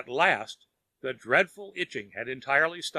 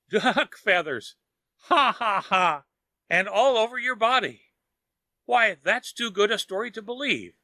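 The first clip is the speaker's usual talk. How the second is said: higher and louder, hearty ha ha ha; roaring laugh; 6 semitones higher, 4 dB louder than usual. A narrator's reading. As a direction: laugh it out, amused disbelief. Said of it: "answered him only with a loud laugh, which burst into a roar".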